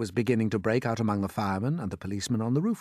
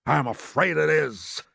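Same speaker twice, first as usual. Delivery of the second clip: dreading confirmation; 4 semitones higher, 3 dB louder, slower than usual. A narrator's reading. Chief confirms the dreadful news.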